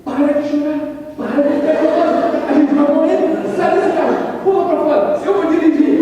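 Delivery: high, loud panicked commands; frantic shouting. Retold or imitated. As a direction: shout at her to get out of the seat. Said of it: "Eu falei". (marido gritando desesperado com a esposa que chora)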